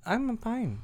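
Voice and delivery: bright, clipped polite "I'm fine"; generic "I'm fine". Shown as a demonstration. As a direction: brush it off politely. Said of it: "the very generic way".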